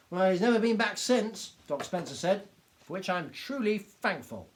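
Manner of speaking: lightly voiced little doctor, gleeful. A narrator's reading.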